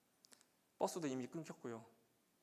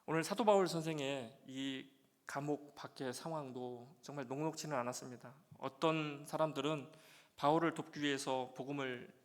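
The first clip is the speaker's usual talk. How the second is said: emphatic pulpit voice; preaching; 2 semitones higher, 7 dB louder than usual. Acted. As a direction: preach it out to the congregation. Preacher returns to sermon.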